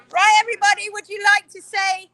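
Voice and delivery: high, loud call to the whole room; rally the group like a host; loud host call to the whole workshop; calling out to everyone. Real, not acted.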